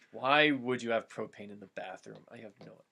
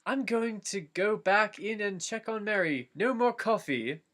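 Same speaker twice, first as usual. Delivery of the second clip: higher, firm Frodo; Frodo voiced; 8 semitones higher than usual. A narrator's reading. Frodo telling Gandalf no more coffee, higher. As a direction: lay down the rule, higher. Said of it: "Frodo speaks".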